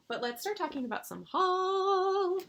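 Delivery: high, loud announcement with a long-held last word; slow down and belt out the final word high; Olivia. Acted. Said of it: "That was my best Olivia impression".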